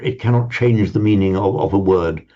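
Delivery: usual speaking voice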